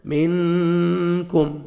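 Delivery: منکم with the ن nasal before ک, slowly; ikhfa on nun. Shown as a demonstration. Teacher models ikhfa, slow. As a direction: hide the ن of منکم in the nose. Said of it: "اخفا کرنا ہے".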